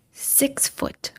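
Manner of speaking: breathy whisper, setting up the reveal; hushed build-up. Real, not acted. Telling her viewers about the man, in a whisper. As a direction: whisper it like a secret.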